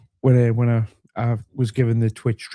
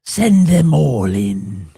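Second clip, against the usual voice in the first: slow, loud villain voice; evil villain voice; 4 dB louder, much slower than usual. Pretend. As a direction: gloat like a movie villain. Villain mocking Danny's trolls.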